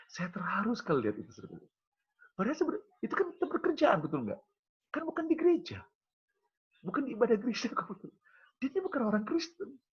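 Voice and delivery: preacher to congregation, moved and amazed; moved marvel. Real, not acted. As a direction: marvel aloud at it happening at work.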